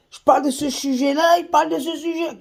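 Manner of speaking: high-pitched mock whine; mocking complaint.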